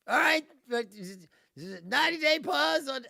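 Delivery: mock Trump backpedal. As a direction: announce the retreat with fake pomp. Acting Trump backing down.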